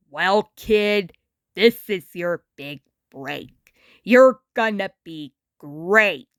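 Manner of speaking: put-on a worn-out celebrity talking down to a kid; Muddy Mud Skipper.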